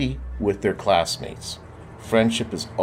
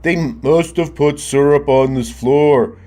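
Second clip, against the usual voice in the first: put-on a kid grumbling about the sticky floor, raised voice; Marcel's voice; 4 semitones higher, 5 dB louder than usual. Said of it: "said Marcel".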